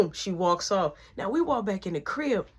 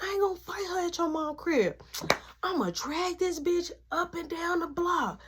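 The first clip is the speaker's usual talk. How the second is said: high, sassy imitation of the girl's threat; mock angry girlfriend; 9 semitones higher than usual.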